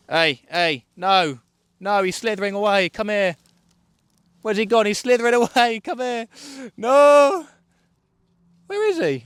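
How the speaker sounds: high, loud, frantic calls after a slippery eel; frantic chasing. Real, not acted. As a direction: yell after it as it slithers off.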